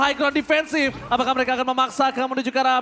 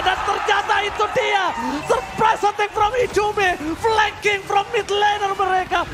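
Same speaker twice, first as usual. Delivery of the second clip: fighting game announcer; 8 semitones higher than usual. Real, not acted. Caster calls the flank.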